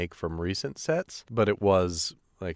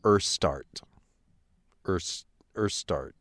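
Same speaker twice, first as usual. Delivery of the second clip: mock solemn reading; slower than usual. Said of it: "That's enough sarcasm".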